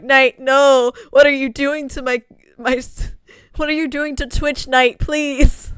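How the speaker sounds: high, loud begging cry; loud desperate pleading. Acted. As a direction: beg her to stop, panicking. Viewers begging Night over lag.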